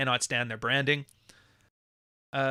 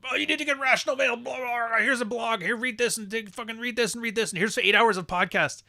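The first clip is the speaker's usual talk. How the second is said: high, fast, gushing mock voice; mocking red pill pusher; 9 semitones higher than usual. Mocking a red pill zealot who pushes blogs, gushing high and fast. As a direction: rattle it off like an eager zealot.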